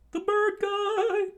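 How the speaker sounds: high falsetto cry, loud and drawn out; acted excited onlooker yell; excited shout. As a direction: call it out slowly in a squealing falsetto. Crowd spots the bird guy.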